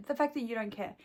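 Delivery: normal talking voice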